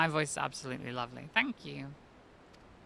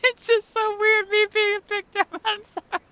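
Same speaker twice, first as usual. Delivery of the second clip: high, loud words through laughter; laughing hard; 10 dB louder than usual. Real, not acted. Streamer laughing at her character becoming a Pictomancer, cackling high. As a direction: laugh your way through the line.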